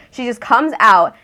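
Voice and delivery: ordinary talk, nothing marked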